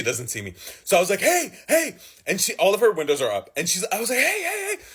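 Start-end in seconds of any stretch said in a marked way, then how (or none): 1.2-2.0 high yelled hey; mock call-out. Yelling at driver to notice. 4.2-4.8 high, frantic calling; high panicky hey. Retold or imitated. Yelling at unseeing driver.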